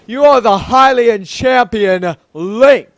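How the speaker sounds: loud, slow royal proclamation; deep manly voice. Acted. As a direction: declare it grandly as a manly king. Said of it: "let's give him a deep, manly voice".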